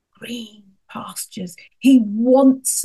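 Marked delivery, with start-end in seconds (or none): 0.1-1.6 soft whispered green pastures. Acted. Preacher to listeners, dwelling on green pastures in a whisper. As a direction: breathe the words gently. Tender whisper after list.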